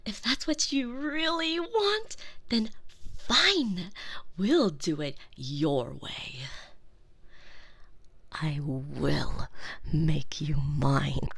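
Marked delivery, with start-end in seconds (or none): 4.4-11.3 low, slow threatening whisper; menacing whisper. Acted. Girlfriend threatening her captive in a low, slow whisper. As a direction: promise ownership in a low hush.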